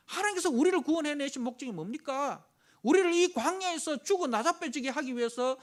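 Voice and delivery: the people accusing God; indignant complaint. Retold or imitated. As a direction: throw the accusation back at God. Preacher re-voicing the complaint.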